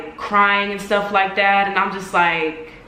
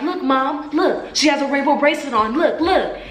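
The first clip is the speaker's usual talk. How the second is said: higher, insistent mock tattletale; quick, high-pitched retold tattling; tattling sister; 5 semitones higher than usual. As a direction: imitate a sister urgently telling on someone. Sister tattling to mom in quarrel.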